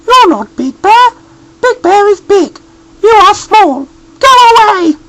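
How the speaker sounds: very high, loud scolding mother bear; high, loud cross mother voice; cross Mummy Bear. A narrator's reading. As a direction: shoo the stranger off the doorstep. Mummy Bear sends him away.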